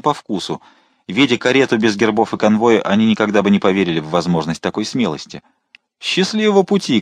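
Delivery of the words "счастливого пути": high, cheerful shouted farewell. A narrator's reading. Townsmen calling good luck to the carriage, shouting. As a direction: call it out after the carriage. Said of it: "крикнули они".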